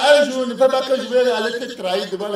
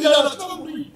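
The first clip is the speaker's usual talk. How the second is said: higher put-on voice, mock menace; put-on threat; 6 semitones higher than usual. Husband refusing his wife's plea for a truce. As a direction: play the husband's threat in a raised voice.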